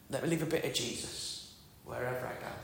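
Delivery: very soft prayer; softly repeated personal prayer; quiet prayer. Retold or imitated. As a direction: pray it low and hushed. Repeats his prayer aloud.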